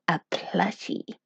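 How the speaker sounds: hushed, breathy aside; whisper. Acted. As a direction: drop to a secret whisper. Whispering a secret human word.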